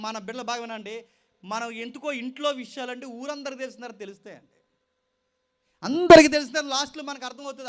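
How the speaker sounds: loud, impassioned sermon to the youth; preaching at full force. Acted. Preacher warning young people against suicide, loud and impassioned. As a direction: thunder it out like a pulpit warning.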